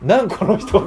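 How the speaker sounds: mildly exasperated what's with this guy; a light put-upon retort; annoyed. Retold or imitated. Teacher echoes the child's irritated reaction, mildly exasperated. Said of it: "って思う".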